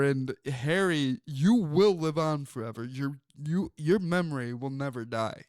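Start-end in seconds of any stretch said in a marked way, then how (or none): none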